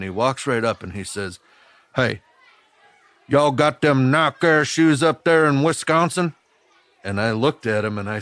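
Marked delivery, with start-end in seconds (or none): 2.0-6.3 a mock Tennessee kid's accent, higher and louder; imitating Tennessee accent. Bully on the playground teasing the new kid, higher, louder Tennessee accent. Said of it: "the way his Tennessee accent came to me".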